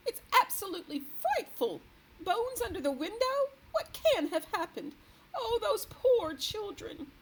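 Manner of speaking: high, loud and distressed; aghast, near tears at the end; horrified. A narrator's reading. The queen reacting in horror to the missing children.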